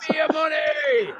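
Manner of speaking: high cartoon villain shout. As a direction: demand the money like a greedy villain. Mock villain demands money.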